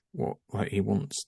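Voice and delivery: usual speaking voice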